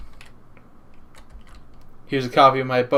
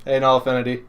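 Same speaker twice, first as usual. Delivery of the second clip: ordinary talk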